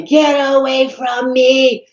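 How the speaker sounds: slow, high mock yell at a child; imitating a man suddenly turning angry; drawn-out high imitated shout; retold yell. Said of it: "he started just yelling at me".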